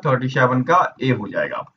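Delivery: ordinary talk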